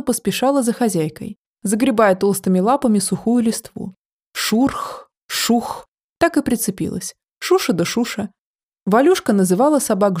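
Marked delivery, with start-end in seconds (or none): 4.3-5.9 breathy shuffling noise; whispered rustling. Acted. Rustling paws onomatopoeia.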